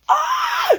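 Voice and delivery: very high fangirl squeal; excited squeal. Real, not acted. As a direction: let out a thin, high squeal. Overcome by the romantic lines.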